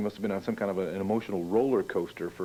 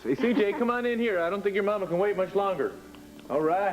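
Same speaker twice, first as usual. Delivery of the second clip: put-on high, raised call to a child; 10 semitones higher than usual. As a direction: call him in from the hall. Calling son in from outside.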